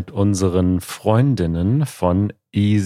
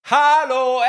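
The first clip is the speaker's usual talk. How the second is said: echo call. Shown as a demonstration. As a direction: call out high to test the echo. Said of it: "jetzt zeig uns mal den Hall".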